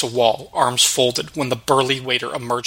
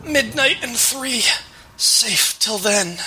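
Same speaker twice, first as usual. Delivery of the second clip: slow, much higher, fading dying words; dying gasp; 10 semitones higher, slower than usual. Acted. Dying prosecutor whispering his last clue to Wayne, slow and strained. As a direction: barely get the words out as life slips away. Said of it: "bloodless lips barely moved".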